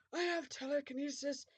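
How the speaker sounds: lightly voiced cruel villain; put-on villain voice, mildly cruel. A narrator's reading. Voldemort answering Ebony, mildly cruel. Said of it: "he answered cruelly".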